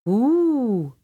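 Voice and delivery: high, loud owl hoot. A narrator's reading. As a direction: hoot it long, rising then falling. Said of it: "an owl hooted".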